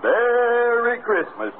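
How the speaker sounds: high, slow, drawn-out Merry Christmas; sing-song jolly Santa voice; Santa's greeting. Acted. Santa greeting the emperor Nero, high and slow.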